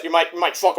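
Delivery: ordinary delivery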